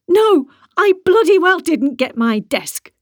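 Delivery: high, loud and slow, spitting each word; angry yell. A narrator's reading. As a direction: yell like a fishwife. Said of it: "she yelled".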